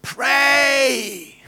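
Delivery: sudden high, loud shout of one word; urgent yell from the man beside the paralysed boy; shouted command. Retold or imitated. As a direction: bark the order right into his ear. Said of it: "och skrek".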